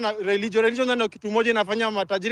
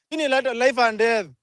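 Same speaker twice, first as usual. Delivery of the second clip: normal talking voice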